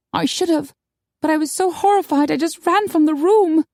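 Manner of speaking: high, rueful character voice; Maggie, horrified. A narrator's reading. Horrified woman admitting she ran away, high and rueful.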